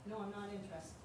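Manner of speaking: faint, off-mic voice; speaking with the microphone off. Real, not acted. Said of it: "My mic is off".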